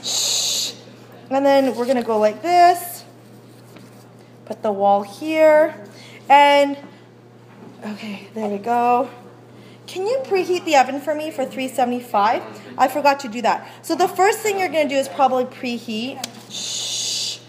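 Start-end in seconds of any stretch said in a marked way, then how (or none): 0.0-0.7 a soft unvoiced shush; hushing. Real, not acted. Teacher hushing her chatty class, soft shush. 16.5-17.4 a soft unvoiced shush; hushing. Real, not acted. Teacher hushing her chatty class, soft shush.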